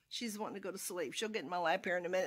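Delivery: usual speaking voice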